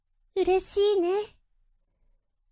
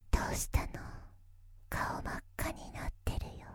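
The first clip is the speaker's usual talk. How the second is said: breathy whisper right at the ear; 13 dB quieter, much faster than usual. Acted. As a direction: whisper softly into their ear. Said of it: "耳元でささやかれちゃった".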